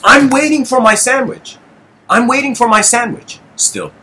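high-pitched indignant insistence; worked up, emotional. Real, not acted. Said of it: "I'm getting emotional here".

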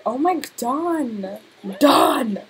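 loud exclaimed shout; calling out. Real, not acted. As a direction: shout the name in dismay. Scolding a game character out loud.

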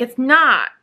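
retold firm objection. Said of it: "And I'm like".

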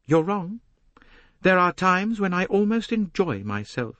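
put-on high, defiant young woman; Jacqueline, defiant. Young woman rejects the detective's advice, defiant high voice.

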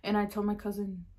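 ordinary delivery

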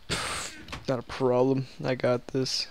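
ordinary talk, nothing marked

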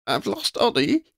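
high stunned question; a jolt of disbelief in the voice; in shock. A narrator's reading. Lydia learns her mentor is gone, shocked and high. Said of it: "she asked, in shock".

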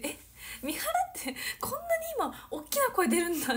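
high-pitched replay of astonishment; retold surprise. Idol retelling her shock at a friend's big voice, surprised. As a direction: recount being startled, voice jumping up high. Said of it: "私びっくりして".

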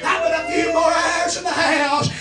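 slow sung preaching whoop; hollering revival preacher. Acted. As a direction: stretch and holler each line.

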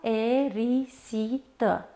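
slow, syllable by syllable: a-e-ri-si-tă; despărțit în silabe. Shown as a demonstration. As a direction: break "Aerisită" into separate syllables with clear pauses. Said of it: "Aerisită se desparte astfel". (rostit rar, pe silabe)

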